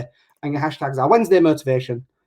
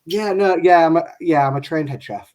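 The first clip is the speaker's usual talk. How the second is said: usual speaking voice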